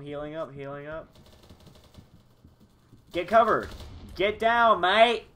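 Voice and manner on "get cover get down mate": urgent shouted warning; grenade warning yell. Acted. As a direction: yell at them to take cover.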